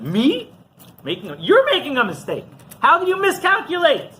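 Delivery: high-pitched mock affront; indignant retort. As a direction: throw the blame back, incredulous. Rabbi turns the accusation back.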